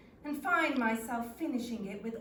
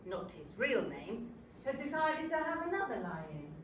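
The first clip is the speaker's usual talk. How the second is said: usual speaking voice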